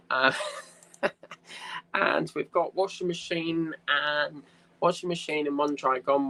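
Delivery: normal talking voice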